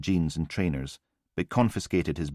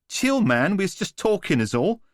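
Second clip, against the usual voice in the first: much higher, dismissive chav; cocky street thug; 13 semitones higher, 5 dB louder than usual. A narrator's reading. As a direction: shrug it off like a smug geezer. Newman brushing it off.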